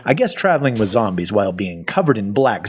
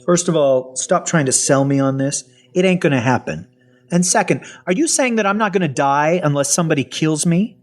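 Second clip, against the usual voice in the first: higher-pitched, insistent objection; indignant refusal; 6 semitones higher than usual. Acted. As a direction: cut the pitch short, then question in disbelief. Narrator acts out refusing the researcher.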